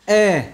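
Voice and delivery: e kept narrow and short. Shown as a demonstration. Teacher showing a short narrow e. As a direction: clip the e sound short. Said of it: "hẹp và không kéo dài".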